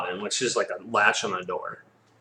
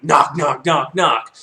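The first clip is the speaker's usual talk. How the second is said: loud, higher, punched knocks; pounding knocks; 4 semitones higher, 8 dB louder, much slower than usual. Acted. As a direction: bang out each knock with your voice. Imitates lumberjack's pounding.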